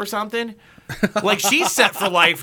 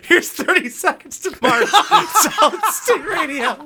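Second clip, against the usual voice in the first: higher, laughing mock DJ; laughing radio intro; 11 semitones higher than usual. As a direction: announce the song while cracking up.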